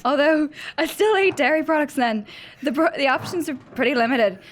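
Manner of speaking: reading aloud in a put-on Irish accent; lilting Irish accent, read out. Shown as a demonstration. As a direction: attempt an Irish accent from Cork. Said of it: "your Irish accent".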